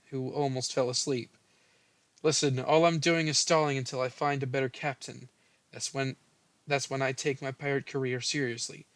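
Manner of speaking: normal talking voice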